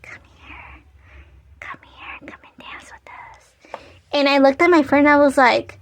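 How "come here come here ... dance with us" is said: soft eerie whispered call; whispering. Retold or imitated. The dancing women beckoning the narrator, whispering. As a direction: lure them in with a ghostly whisper. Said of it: "Like, whispering".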